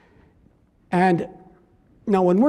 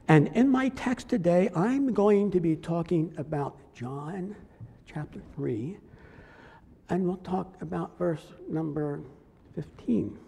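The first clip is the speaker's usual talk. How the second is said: softer, gentle imitation of a mild preacher; light, calm preaching; 7 dB quieter than usual. Imitating Nettleton greeting his congregation, soft and calm. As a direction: ease into the text calmly, no drama. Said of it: "he would speak very lightly".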